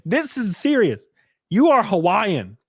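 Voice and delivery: usual speaking voice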